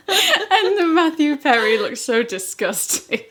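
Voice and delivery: high, loud, slow laughing delivery; really giggling through the words; laughing while talking. Real, not acted. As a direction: crack up while finishing the story. Cracking up over own anecdote.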